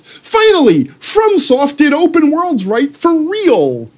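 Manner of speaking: high, gushing fanboy voice; mocking gushing fan. Gamers quoting Elden Ring with mock gushing fanboy voice. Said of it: "I'm quoting morons".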